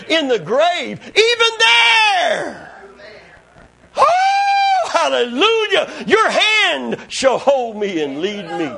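soaring shouts with drawn-out words; full-force preaching. Real, not acted. Preacher proclaiming God's faithfulness to congregation, full-force shouted preaching. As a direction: build to an ecstatic, shouted peak.

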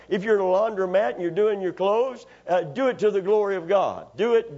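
ordinary delivery

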